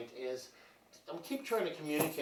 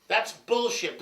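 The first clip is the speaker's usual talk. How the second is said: higher, louder second take; a louder, higher "That's bullshit."; loud repeat; 10 semitones higher, 9 dB louder, slower than usual. Shown as a demonstration.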